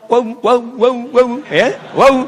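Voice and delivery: "waw" with lips slightly apart and rounded, loud; teacher booming "waw" for the class. Shown as a demonstration. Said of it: "Agak terpisah".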